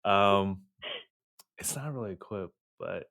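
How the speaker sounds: ordinary delivery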